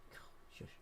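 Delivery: hushed whisper to himself. Real, not acted. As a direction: breathe it out under your breath.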